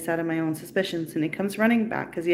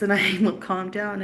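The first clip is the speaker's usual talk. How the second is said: ordinary talk, nothing marked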